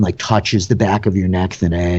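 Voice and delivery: ordinary talk, nothing marked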